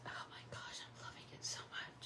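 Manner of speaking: delighted breathy whisper; hushed excited whisper. Real, not acted.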